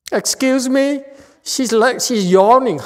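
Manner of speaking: mock offended. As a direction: act hurt, but as a joke. Teacher mock-offended by a yawning listener.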